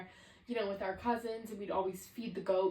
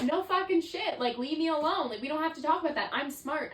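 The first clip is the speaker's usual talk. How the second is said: higher and louder, fed-up retort; exasperated outburst; 8 semitones higher, 7 dB louder than usual. Retold or imitated. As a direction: snap back at the therapist, annoyed. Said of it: "So you're like".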